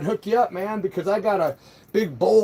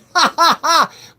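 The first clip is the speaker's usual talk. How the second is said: high, loud incredulous laugh; loud burst of laughter; 9 semitones higher, 9 dB louder than usual. Real, not acted. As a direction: crack up at the insult. Laughing at troll calling him puss.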